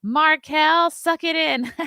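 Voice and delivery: high, loud mock producer yell; retold scream. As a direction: bark it like a pushy producer. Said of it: "would scream".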